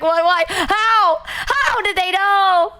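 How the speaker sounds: high, slow, astonished; shocked. Retold or imitated. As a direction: cry out in disbelief. Retold shock.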